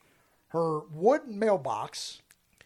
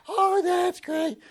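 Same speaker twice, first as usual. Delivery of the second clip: put-on high, slow little old lady; 14 semitones higher, 4 dB louder, much slower than usual. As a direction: squeak it out like an elderly lady. Voicing the old lady customer.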